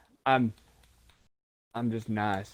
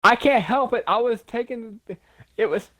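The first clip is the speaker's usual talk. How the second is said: protesting; 8 semitones higher, 3 dB louder than usual. Real, not acted. Protesting teasing about accent.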